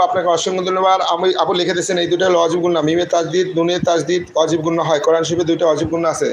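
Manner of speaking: normal talking voice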